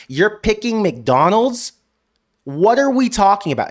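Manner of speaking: loud, high, exasperated rant. Real, not acted. Fan host ranting to viewers about the coach's choice, loud and exasperated. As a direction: hammer the disbelief, slowing on the punchlines. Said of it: "I'm not ranting or yelling at you".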